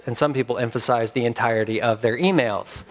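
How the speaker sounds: usual speaking voice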